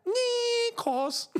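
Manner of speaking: high Scouse voice stretching out Nikos; Steven Gerrard impression. Streamer doing the Liverpool captain's catchphrase, mock Scouse. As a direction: mimic a footballer's catchphrase in a thick Scouse accent. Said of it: "Steven Gerrard's impersonation of".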